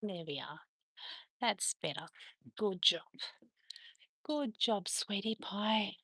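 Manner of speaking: soft, soothing praise for a pet; tender praise. Real, not acted. Owner settles dog into a cuddle, soft tender praise. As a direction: murmur it lovingly, very softly.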